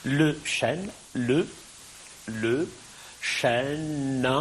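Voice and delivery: "le chêne" split into separate syllables, pauses between. Shown as a demonstration. Teacher dividing the verse for students, syllable by syllable. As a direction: space out each syllable of "le chêne". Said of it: "chaque syllabe n'ayant qu'une voyelle prononcée".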